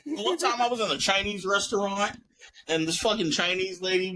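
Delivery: normal talking voice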